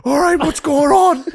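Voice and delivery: high, loud mock fluster; flustered dad. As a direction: babble it in a cracking falsetto. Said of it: "He was like".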